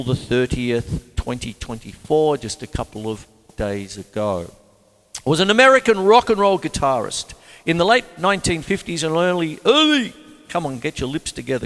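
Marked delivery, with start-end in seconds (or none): 5.2-10.1 a louder, brighter reading voice, high and fast; reading the facts out like a radio presenter; read-aloud biography. Acted. Reading his biography aloud to viewers in a louder presenter voice.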